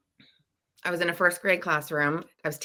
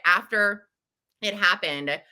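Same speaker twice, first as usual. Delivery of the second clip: normal talking voice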